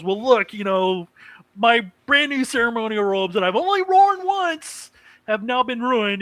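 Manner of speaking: lightly voiced upset girl; mock indignant girl. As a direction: whine about the ruined robes. Girl complaining about ruined robes.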